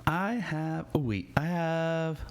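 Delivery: ordinary talk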